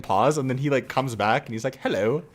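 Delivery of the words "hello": imitating a British hello, pitched up a little; British-accented hello with a rising lift; higher-pitched British accent. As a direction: put on a lighter British accent. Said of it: "his, like, much higher pitched British accent".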